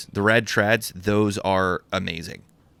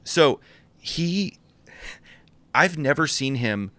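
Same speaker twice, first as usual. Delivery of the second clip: ordinary delivery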